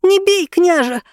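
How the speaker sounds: high, quick frightened plea; hasty plea. A narrator's reading. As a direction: blurt it out to stop the whip. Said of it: "поспешно сказала девочка".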